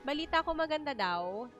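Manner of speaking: ordinary delivery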